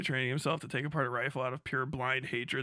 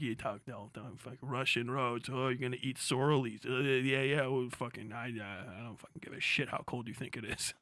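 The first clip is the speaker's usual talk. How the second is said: low mumbling Finnish soldier; 4 semitones lower, 7 dB quieter than usual. Retold or imitated. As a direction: lower and much softer, grumbling.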